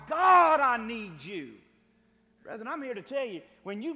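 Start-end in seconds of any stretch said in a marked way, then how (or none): 0.1-1.5 loud, pleading preacher's cry; crying out to God. Acted. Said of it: "just cry out to God".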